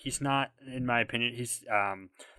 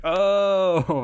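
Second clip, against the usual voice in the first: long, loud, high-pitched "oh"; exaggerated dawning recognition; mock realization; 8 semitones higher, 8 dB louder than usual. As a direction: let the penny drop, hammed-up.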